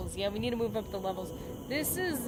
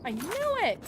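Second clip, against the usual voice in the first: high, loud triumphant cry; excited shout; 9 semitones higher, 4 dB louder than usual. Real, not acted. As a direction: burst out, vindicated.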